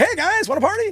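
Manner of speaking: high, cocky party-dude greeting. Retold or imitated. New celebrity greeting the rock legends, cocky party dude. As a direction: play the brash new kid with frosted tips. Brash frosted-tips newcomer greeting rockers.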